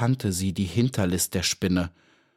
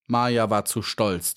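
plain reading voice

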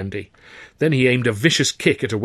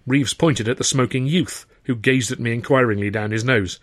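ordinary talk, nothing marked

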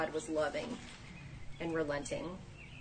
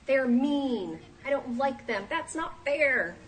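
put-on higher, whiny child-like complaint, dragging out fair; sulking Jonah; 6 semitones higher, 3 dB louder, slower than usual. Jonah complains to God like a sulky child, higher and whiny. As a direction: pout it out like a sulky kid.

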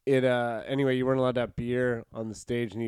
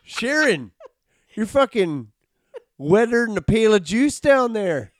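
high, loud hammed-up Canadian accent; mock Canadian; 8 semitones higher, 7 dB louder than usual. Mocking Canadian bedroom talk to Sharon, high loud Canadian. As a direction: croon it like a Canadian lover. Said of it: "Canadian sex talk is incredible".

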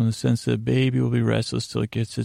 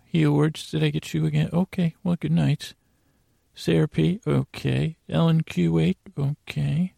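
higher-pitched mock attendance call; roll-call teacher; 5 semitones higher than usual. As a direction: pretend teacher checking names, pitched up.

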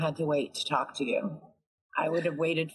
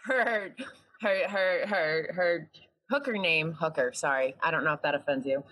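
usual speaking voice

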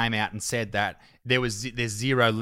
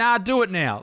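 mocking flip-flop; 10 semitones higher than usual.

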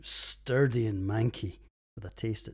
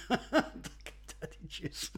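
laughing; 7 semitones higher, much slower than usual. Real, not acted. Whispered through giggles.

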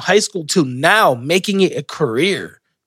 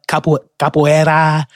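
bad Spanish accent. Shown as a demonstration. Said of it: "I have the worst Spanish accent".

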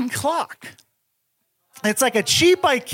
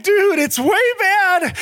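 desperate plea; 8 semitones higher, slower than usual. Retold or imitated. Begging the bodega guy.

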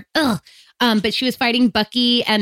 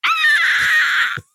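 pterodactyl shriek. Real, not acted. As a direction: let out an ugly pterodactyl screech. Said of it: "That was my ugly pterodactyl screaming".

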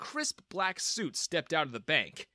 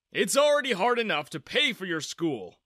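stern father; 6 semitones higher, 7 dB louder than usual. A narrator's reading. Father refusing son's plan.

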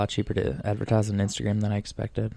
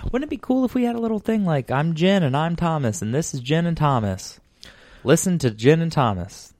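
hyped-up commercial announcer; mock ad voice; 7 semitones higher than usual. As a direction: sell it like a radio ad. Mock podcast ad pitch.

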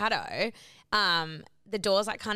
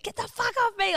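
high-pitched reenacted snap; mock-hysterical angry cry; retold crazed outburst; 4 dB louder than usual. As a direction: replay a frantic push-away. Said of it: "I was, like, going crazy".

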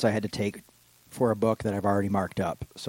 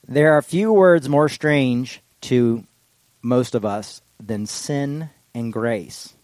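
slow solemn reading; 2 semitones higher, 4 dB louder, slower than usual. Acted. Reads Tillich sermon aloud.

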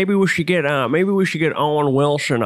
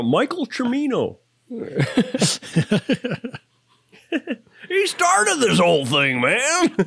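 Jeff Bridges speech; 9 semitones higher, slower than usual. Pretend. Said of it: "listing people off".

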